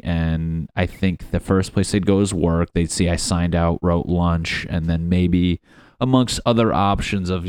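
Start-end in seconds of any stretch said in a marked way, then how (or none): none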